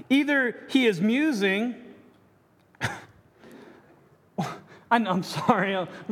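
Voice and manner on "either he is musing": mock taunt, rising on "Either". Elijah taunting the prophets, lightly mocking. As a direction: sneer "Either he is musing" with a lift. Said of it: "Elijah mocked them, saying".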